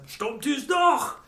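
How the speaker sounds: high, slow put-on movie line; movie quote. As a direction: drawl the line like the film character. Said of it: "I started quoting from it".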